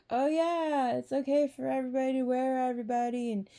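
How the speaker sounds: high, fast, airy mock-breezy voice; sing-song mock approval; mocking society. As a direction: parody a careless crowd saying anything goes. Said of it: "everyone in society is like".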